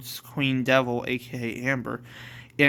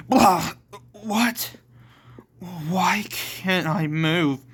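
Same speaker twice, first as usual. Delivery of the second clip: startled high cry, then slowing; frightened confusion; 4 semitones higher, slower than usual. Acted. Edward finds he can't move, frightened. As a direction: gasp out in mock panic. Character suddenly paralysed, frightened.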